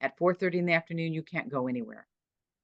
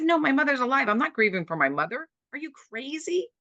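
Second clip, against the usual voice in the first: retold protest, much higher and louder; indignant disbelief; 10 semitones higher, 3 dB louder than usual. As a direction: push back at the friend in shocked disbelief. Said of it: "I said".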